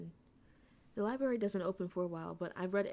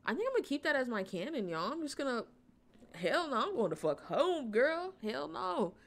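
excited; 5 semitones higher, 4 dB louder than usual. Real, not acted. Reacting to game choice.

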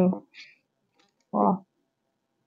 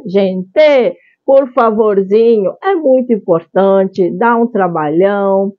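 normal talking voice

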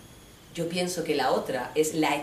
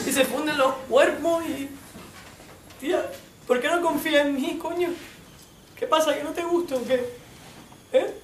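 hammed-up macho poet; 8 semitones higher than usual. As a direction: overplay the boyfriend waxing poetic. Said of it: "Mi colega me lo explica". (novio macarra poniéndose poeta, exagerado y agudo)

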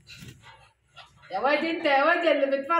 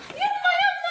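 much higher shouted urging; excited shout; 13 semitones higher, 4 dB louder than usual. Real, not acted. Woman urging her ducks on with a high shout. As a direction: call them on, loud and high.

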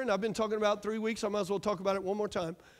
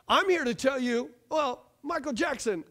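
ordinary talk

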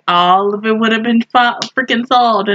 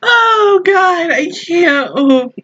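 overcome by laughter; 7 semitones higher, much slower than usual. Real, not acted. Recovering from laughing fit.

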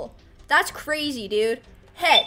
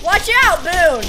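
sharp loud warning shout; 7 dB louder, slower than usual. Real, not acted. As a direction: yell it out urgently. Warning companion mid-fight.

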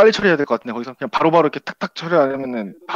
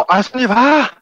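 excited outcry; 9 semitones higher, 6 dB louder, slower than usual. Real, not acted. Near miss in the match.

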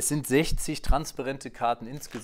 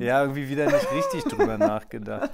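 laughing; 2 semitones higher, 7 dB louder than usual. Real, not acted. Laughing at his own failed card.

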